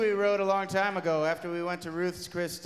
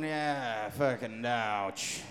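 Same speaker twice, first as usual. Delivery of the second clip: low pained groan; 8 semitones lower, 3 dB quieter, much slower than usual. Retold or imitated. Retold groan in the car.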